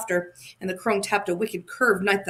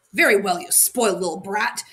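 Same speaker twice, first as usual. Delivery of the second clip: ordinary delivery, nothing marked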